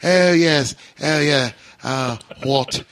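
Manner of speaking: hammed-up mock-posh British accent; bad toff British accent; horrible British accent. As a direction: play an American badly faking British. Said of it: "let's all do our horrible American British".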